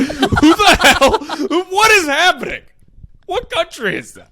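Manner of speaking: incredulous outburst. Real, not acted. Reacting to the squirrel wedding story, high and incredulous. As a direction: stretch out the disbelief.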